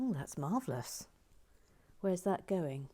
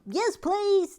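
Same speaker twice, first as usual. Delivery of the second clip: much higher, louder squeak; an overexcited pleading please; eager yes; 9 dB louder than usual. Pretend. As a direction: leap at the offer. Eager acceptance of job offer.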